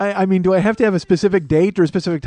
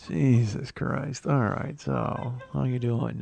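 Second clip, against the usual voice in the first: teeth clenched; 4 semitones lower, 7 dB quieter, much slower than usual. Pretend. Host doing ventriloquist bit, speaking through clenched teeth. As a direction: talk through clenched teeth, slow and soft. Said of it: "with my teeth clenched".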